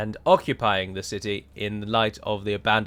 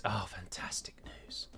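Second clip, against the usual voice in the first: slow whispered "fantastic news"; sarcastic whisper; 4 semitones lower, 16 dB quieter, slower than usual. Real, not acted. Sarcastic about two garrison units.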